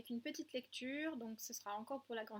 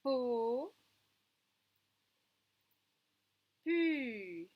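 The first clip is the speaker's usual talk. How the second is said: loud syllable reading; 2 semitones higher, 7 dB louder than usual. Shown as a demonstration. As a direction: sound out each syllable, louder. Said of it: "syllabes lues au tableau". (syllabes lancées fort)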